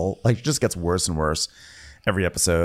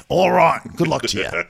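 louder, higher "all right"; mock grave doctor; 7 semitones higher, 4 dB louder than usual. Cohost parodying the captain-doctor. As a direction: sign off like a cheerful surgeon. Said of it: "here's the tough truth".

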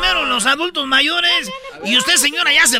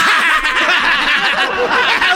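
mocking laugh; 3 semitones higher than usual. Official hearing the donkey is a transformer, mocking laugh. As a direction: burst out laughing in derision.

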